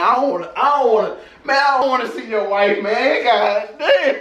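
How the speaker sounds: high, fast, laughing through the words; laughing protest. Retold or imitated. Telling his cellmate he won't look at the pictures, laughing. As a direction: protest while cracking up, pitched high.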